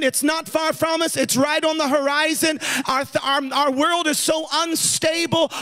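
impassioned preacher driving the point home; preaching. Real, not acted. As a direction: preach it insistently, building in intensity. Preaches a warning to the congregation.

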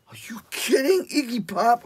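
high-pitched thrilled squeal; squealing disbelief. Retold or imitated. Drummer hearing the [name] offer, squealing with excitement.

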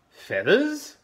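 very high, loud rising question; surprised Dingo. Acted. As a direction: squeak it up in surprise. Dingo voiced in story.

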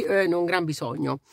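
ordinary delivery

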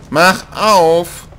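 "Mach" punched high and loud; demanding yell, "Mach" hit hard; impatient shout. Real, not acted. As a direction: bark "Mach auf" at the door.

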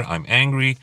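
lightly acted irritation; acted anger. Shown as a demonstration. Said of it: "we'll use anger".

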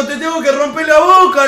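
loud, high angry outburst reliving the fight; retold furious insult. Retold angry confrontation with friend. (al amigo que confiesa, grito furioso y agudo)

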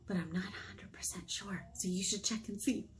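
soft whispered admission; whispered aside. Real, not acted. Librarian admitting to kids she isn't sure, whispered. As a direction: let it slip under your breath. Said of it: "unsure aside about theme".

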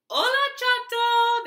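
high, loud greeting call. Acted. Mice greeting Chato at his door, high loud call. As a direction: call across to Chato's house. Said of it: "they called".

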